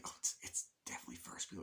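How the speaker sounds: fast, hushed whisper; conspiratorial acted whisper; whispered aside. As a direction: whisper it quickly, away from the phone. Covert aside during retold phone call.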